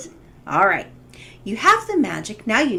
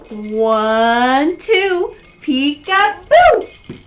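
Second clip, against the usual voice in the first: high, loud playful magic chant; kids party magician; 4 semitones higher, 6 dB louder, much slower than usual. Pretend. Saying the magic words together.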